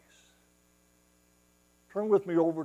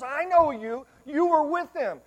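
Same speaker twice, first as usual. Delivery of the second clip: accusing woman; 13 semitones higher, 10 dB louder than usual. Acted.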